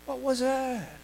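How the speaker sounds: ordinary delivery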